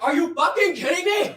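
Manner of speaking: high, loud angry yell; furious shout. Retold or imitated. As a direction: yell it in disbelief. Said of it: "And Sasha's like".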